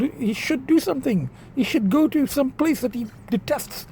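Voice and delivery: ordinary delivery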